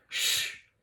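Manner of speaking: Ch as a soft voiceless hiss; ch sound. Shown as a demonstration. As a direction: hiss the Ch quietly. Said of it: "c'est ça". (Ch chuchoté)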